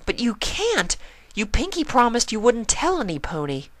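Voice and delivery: high, mild pleading; a touch of begging; pleading Twilight. A narrator's reading. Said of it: "Twilight pleaded".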